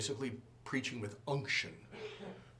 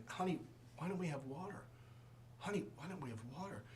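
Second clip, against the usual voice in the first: soft gentle asking; 12 dB quieter, faster than usual. Retold or imitated. Husband asking his wife about the water, softly. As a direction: keep it hushed and mild, like a repeated household query.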